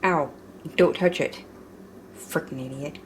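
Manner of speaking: ordinary talk, nothing marked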